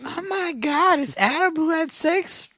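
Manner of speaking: high-pitched gushing fan; squealing fan voice. Pretend. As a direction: squeal it like a starstruck fangirl. Mocking a fan who recognizes him.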